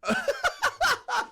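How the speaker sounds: high burst of laughter; hearty laugh; loud laugh. Real, not acted. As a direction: crack up at the joke. Cracking up at chat.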